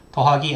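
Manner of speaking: 더하기 said as the Korean word, in the middle of an English equation; Korean word for plus. Shown as a demonstration. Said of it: "is said in Korean as 더하기".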